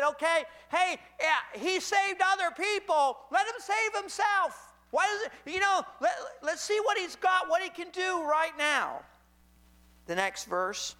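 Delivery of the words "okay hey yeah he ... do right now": much higher, sneering taunt; mocking ruler. Preacher voicing rulers who taunt Jesus on the cross, higher and sneering. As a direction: jeer at him like a scoffing ruler. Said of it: "So he said".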